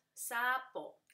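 "Sapo" as two separate beats; split into syllables. Shown as a demonstration. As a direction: break "Sapo" into sa, po. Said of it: "se divide".

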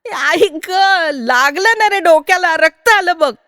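loud, high acted cry of hurt; yelp, then complain like an injured kid; child's high-pitched pained outcry; pained child wailing. Child hit on head, bleeding.

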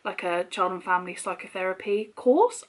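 ordinary delivery

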